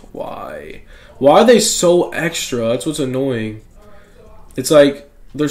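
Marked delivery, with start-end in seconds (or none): 0.1-0.8 sighed, barely voiced plea; exasperated whisper. Real, not acted. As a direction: breathe it out in defeat. Disappointed by worthless cards.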